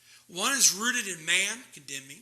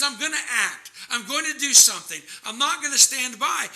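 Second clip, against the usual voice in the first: raised, resolute voice of divine anger; righteous anger; 5 semitones higher, 5 dB louder than usual. Acted. God declares he will act, righteous anger. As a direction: preach it as God's forceful resolve. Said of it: "it's God's righteous anger who says".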